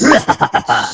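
a loud, quick laugh at the joke; short burst of laughter. Acted. Jan laughing at her brother's teasing. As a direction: laugh out loud, caught off guard.

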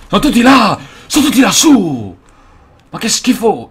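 high, very loud outburst; real angry shouting. Real, not acted. Gamer losing his temper at enemies up above, furious shouting. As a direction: yell it out in exasperation. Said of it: "a non incazzarmi".

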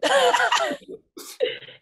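bright high-pitched laugh. Real, not acted. Interviewer laughs.

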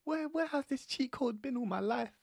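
high, loud delighted surprise; mock-amazed realization. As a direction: gasp at the discovery. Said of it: "I'm thinking".

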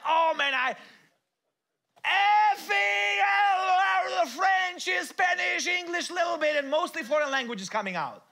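hammed-up shout, very high and loud; stressed parent exploding at the kids; mock furious rant. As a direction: blow up in comic frustration. Acted cursing while squeezed.

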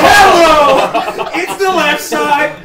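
excited shout. Real, not acted. Gloating over new gun.

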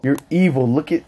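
ordinary delivery

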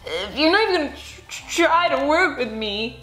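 a hurt monster whining and tripping over words. Acted. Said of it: "Mac whined".

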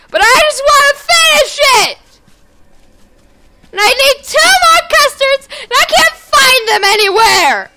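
very high, loud wailing complaint; frustrated yelling. Real, not acted. Player unable to find the items needed, frustrated high yelling. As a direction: yell every word in exasperation. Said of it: "Sorry, I'm yelling. I'm frustrated".

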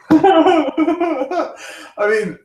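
high giggle breaking into the words; laughing. Real, not acted. Remembering the Zuckerberg pitch, high laughing. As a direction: laugh through "I mean".

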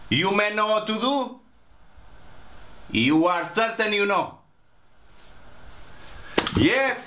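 flustered Spanish waiter in broken English; broken Spanish accent. Acted. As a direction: blurt it out in a thick Spanish accent, eager and confused. Waiter in a hotel sitcom.

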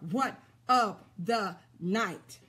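drawn out word by word, low; slow emphatic refrain. Real, not acted. Preacher driving the refrain home to the congregation, slow and emphatic. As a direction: space out every word slowly.

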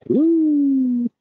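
high drawn-out ooh of wonder; impressed ooh. Real, not acted. On a walk, spots something cool, a high impressed ooh. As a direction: let it rise way up, impressed.

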